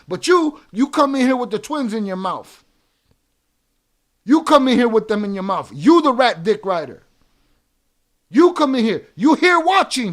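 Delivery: loud, heated shouting; furious rant. Real, not acted. Streamer yells at chatter calling him a hoe, furious. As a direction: yell him down. Streamer berating chat viewer.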